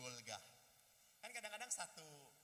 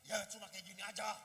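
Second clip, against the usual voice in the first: tense, louder mock-defensive retort; denied anger, acted; 7 dB louder than usual. Person denying anger to questioner, strained mock retort. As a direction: insist nothing is wrong, voice giving it away. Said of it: "Kenapa suara kamu kayak gitu?".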